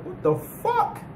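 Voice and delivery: squeaky high startled what; a character's high-pitched startled outburst; retold shock. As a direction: jump way up in pitch, caught off guard. Zebra senses the ground moving.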